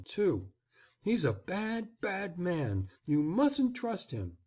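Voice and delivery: flattering, excited. A narrator's reading. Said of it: "a voice flattering with the excitement quivering in it".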